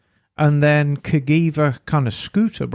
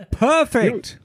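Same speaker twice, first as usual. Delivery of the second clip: laughing; 5 semitones higher than usual. Real, not acted. Laughs at Hoover pipe exhaust.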